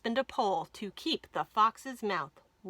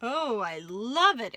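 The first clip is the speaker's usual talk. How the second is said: slow, delighted fox voice; exclaiming fox; 5 dB louder, slower than usual. A narrator's reading. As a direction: gush over the new tooth, drawn out. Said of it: "exclaimed the fox".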